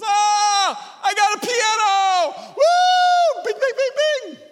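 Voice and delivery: put-on very high, loud squealing kid voice; overjoyed little kid. Mimicking a toddler thrilled with his toy piano, squealing. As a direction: shriek with delight like a child with a new toy. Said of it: "He's filled with enthusiasm".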